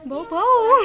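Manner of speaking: laughing dog bark. Shown as a demonstration. Teacher asks a second child, who laughs out the bark. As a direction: giggle through the woof भौ, भौ.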